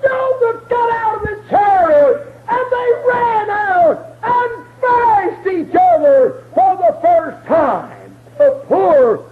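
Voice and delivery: high crying shout, slowing down; preaching climax. Acted. Preacher whooping the reunion to the church. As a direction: whoop it out as they run together.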